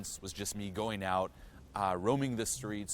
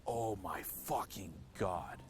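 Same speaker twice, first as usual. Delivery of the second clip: awestruck; much slower than usual. Retold or imitated. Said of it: "I was like".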